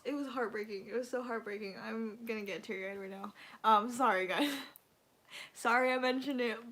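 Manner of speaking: normal talking voice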